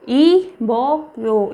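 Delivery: इबोयो drawn out slowly in separate syllables. Shown as a demonstration. Teacher showing learners a new Korean word, इबोयो broken slowly into syllables. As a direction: sound out इबोयो with gaps between syllables.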